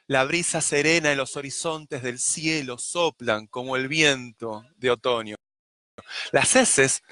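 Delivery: lightly recited verse, letting the sounds breathe; poem reading. Shown as a demonstration. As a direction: recite it as a sample of poetry. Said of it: "la sonoridad tiene que ver con aquello".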